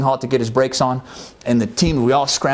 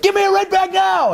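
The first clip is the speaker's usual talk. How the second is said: shouted order; 4 dB louder than usual. Retold or imitated. Major shouting again.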